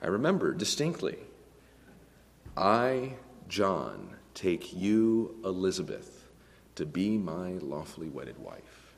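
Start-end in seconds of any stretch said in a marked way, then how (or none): 2.5-8.9 measured, broken into short phrases; solemn wedding vow. Retold or imitated. Groom to bride at the wedding, solemnly. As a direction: recite a vow, sinking low and soft at the end. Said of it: "the most solemn moment".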